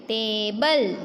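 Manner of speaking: टेबल, first syllable drawn out; long vowel. Shown as a demonstration. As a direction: stretch the "ay" in टेबल. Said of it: "ए का sound थोड़ा लंबा होता है".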